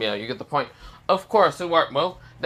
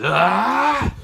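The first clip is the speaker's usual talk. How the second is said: high, loud power-up yell; battle scream; 7 semitones higher, 6 dB louder than usual. Acted. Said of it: "he screams out loud".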